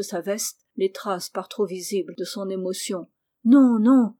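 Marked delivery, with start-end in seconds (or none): 3.4-4.1 loud, urgent protest; narrated outburst, suddenly louder; distraught cry. A narrator's reading. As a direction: cry out in alarm to stop him. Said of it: "s'écria Rose éperdue".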